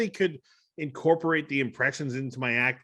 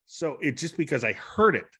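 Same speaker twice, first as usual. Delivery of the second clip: normal talking voice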